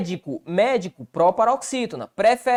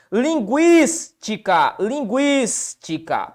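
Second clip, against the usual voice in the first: "Linguística" slow, GUÍS held long before ti-ca; stressed syllable stretched; much slower than usual. Shown as a demonstration. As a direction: lean on GUÍS in "Linguística", pause, finish with ti-ca. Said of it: "Qual que é a regra de linguística?". ("Linguística" devagar, com GUÍS alongado)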